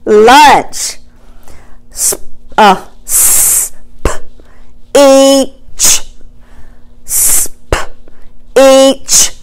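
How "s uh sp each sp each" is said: sp whispered, each apart; chopped word. Shown as a demonstration. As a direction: break speech into sp and each. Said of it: "What about the word".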